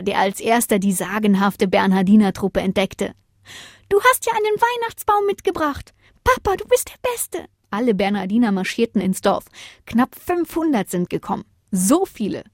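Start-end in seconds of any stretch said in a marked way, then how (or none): 3.9-7.5 very high, thrilled little one; delighted child. A narrator's reading. Bodo's youngest admires his father's tree, delighted.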